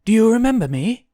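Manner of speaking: high, loud, quick question; excited rush, pitched right up; eager. A narrator's reading. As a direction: ask eagerly, hoping to be recognized. Guinevere eagerly asks the demon.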